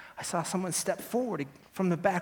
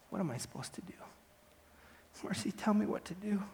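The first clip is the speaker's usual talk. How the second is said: soft, half-whispered question lifting higher; helpless plea; 4 semitones higher, 7 dB quieter than usual. Acted. Cried-out defendant asking Mercy for guidance, hushed helpless plea. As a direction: ask weakly, nearly under your breath.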